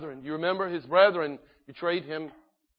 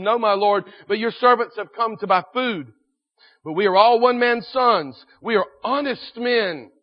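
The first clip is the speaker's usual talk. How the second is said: loud, higher-pitched protest of innocence; self-justifying; 5 semitones higher, 8 dB louder than usual. Acted. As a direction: insist emphatically to a lord, as the accused brothers. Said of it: "they were justifying themselves".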